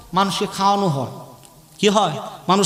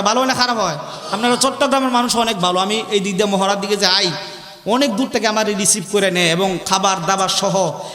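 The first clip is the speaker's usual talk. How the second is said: ordinary talk, nothing marked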